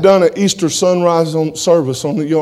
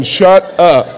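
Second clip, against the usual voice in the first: louder, blunt mock command; a jokey curt order; playful fake snap; mock blunt shut up; 4 semitones higher, 7 dB louder than usual. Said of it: "it's a nice way of saying".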